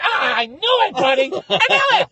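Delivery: high, loud mock scream into gloating; shriek, then crow about it; startled triumph. Visitor to clown who jumped out, startled triumphant shout. Reacting to jump scare triumphantly.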